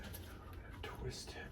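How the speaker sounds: slow whispered self-talk; whispered mutter. Real, not acted. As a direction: mutter it under breath. Muttering while working.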